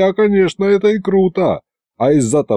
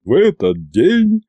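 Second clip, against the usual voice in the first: slow, rolling orator's voice; measured, resounding delivery; Ivan's rolling bass; slower than usual. A narrator's reading. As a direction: draw it out as a public address. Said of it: "продолжил раскатистым басом Иван".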